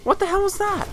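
high shocked cry at the screen. Real, not acted. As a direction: yelp it in disbelief. Shocked in-game.

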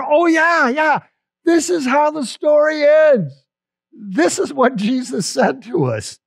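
light up as it all comes back; a bright, wondering "oh yeah" as hope returns; sudden joyful realization. Acted. Disciples suddenly remember Jesus's promise.

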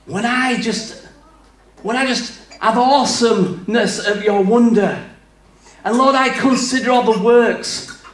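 grand, swelling prayer with long pauses; imitated eloquent prayer. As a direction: mimic a house-group member praying dramatically. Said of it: "they pray like this".

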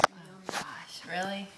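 voiceless worried whisper. Real, not acted. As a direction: whisper it, dreading the taste. Nervous before tasting it.

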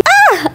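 short high squeal of delight; high happy shriek; excited squeal. Real, not acted. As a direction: squeal like a thrilled fan. Freaking out over hotel.

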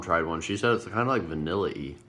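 biting between words; speaking with food; talking while eating. Real, not acted. Seen 1: Holds pink cookie piece at lips, nibbling while talking.

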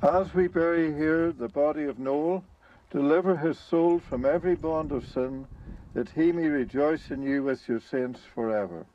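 ordinary talk, nothing marked

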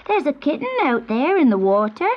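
put-on high, excited child calling out; children's shout. A narrator's reading. Children on the bank spot a kitten, excited high calling. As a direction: call out high with alarm. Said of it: "they shouted".

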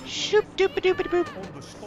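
high, slow "shoop doop" sound effect; little sing-song movement noises, pitched high; silly squeezing noises. Pretend. Player casually squeezing through a gap, silly noises.

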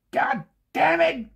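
high, slow, hammed-up American exclamation; bad American accent, dragged out high; mock American accent. Mock American exec phoning up the cartoonist, hammed-up American accent. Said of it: "I can't really do an American one unless I start off by saying".

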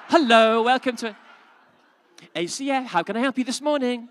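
bright, high, loud operator voice; call center greeting. Pretend. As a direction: answer the phone like a chirpy call center agent. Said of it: "are you doing a call center".

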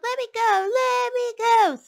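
high loud squeal. A narrator's reading. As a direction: squeal it, struggling. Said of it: "The piglet kicked and squealed".

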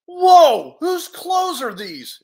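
high, loud whoa; startled amazement. Acted. Hero discovering his strange clothes, startled amazement. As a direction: blurt it in sudden astonishment.

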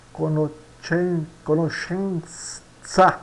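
CONOSCENZA slowly, "co-no-SHEN-tsa"; drawn-out Italian pronunciation. Shown as a demonstration. Teacher models Italian slowly, SC in conoscenza as SH. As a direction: stretch the SH and TS in conoscenza. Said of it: "Isso aqui vai dar um che".

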